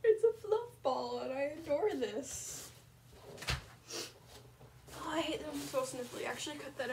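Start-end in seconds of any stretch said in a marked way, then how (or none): 0.0-1.2 squealing. Real, not acted. Squealed cute.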